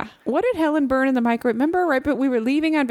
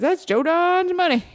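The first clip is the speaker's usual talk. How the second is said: higher, slow, drawn-out quote; imitating a friend; 7 semitones higher, slower than usual. Friend proudly excusing the sale barn smell, imitated drawl. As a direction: mimic his catchphrase, stretching it out. Quoting friend's habitual saying.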